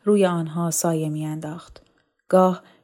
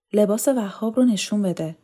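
ordinary delivery

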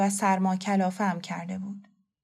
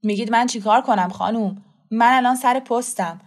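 loud, firm official refusal; exasperated policeman; 5 dB louder than usual. Acted. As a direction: push back at the woman as an officer on duty. (مأمور پلیس کلافه با صدای بلند)

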